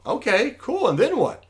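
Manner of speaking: high-pitched, eager acted reply; acted excitement. Fisherman to businessman about the plan, acted excitement. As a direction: let the pitch leap with imitated eagerness. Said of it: "looking really excited".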